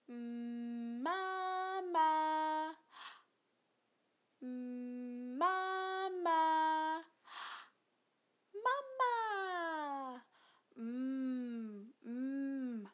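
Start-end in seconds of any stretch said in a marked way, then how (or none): 0.1-10.2 "Mama" sung slowly, lips pressed together on each m; clear demo. Shown as a demonstration. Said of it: "Put your lips together".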